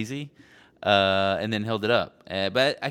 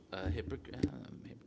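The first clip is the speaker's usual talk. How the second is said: muttered to self; 12 dB quieter than usual. Real, not acted. Searching for the word.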